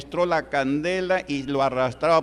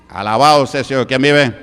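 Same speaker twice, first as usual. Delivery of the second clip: loud call to the congregation; rally the crowd with a shout; praise shout; 8 dB louder than usual. Real, not acted. Pastor rousing the church, loud call.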